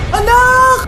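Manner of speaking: hammed-up mother's grief shout; anguished shout. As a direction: cry out for the child. Mother finds child dead.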